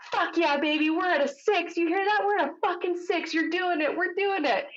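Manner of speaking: jazzed-up husband. Retold or imitated. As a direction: imitate him cheering her on, over the moon. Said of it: "He's so jazzed up in the corner".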